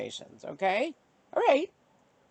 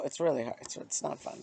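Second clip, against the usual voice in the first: whispered; 5 semitones lower, 9 dB quieter, faster than usual. Real, not acted. Admitting fear is hard.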